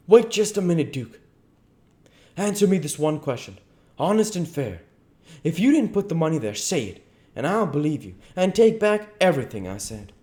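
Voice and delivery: high, earnest appeal; pleading king. Acted. King bargaining with the duke, urgent pleading. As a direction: bargain anxiously for an honest answer.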